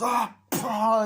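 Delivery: high, loud burst of a yell; sudden loud cry; acted attack shout. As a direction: yell it out like landing a blow. Acting out the attack.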